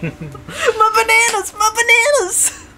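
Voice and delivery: slow, high-pitched cartoon wail; mock wailing. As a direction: lament a loss in hammed-up anguish. Voices Tetsuya wailing over bananas.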